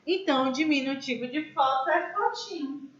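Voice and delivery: normal talking voice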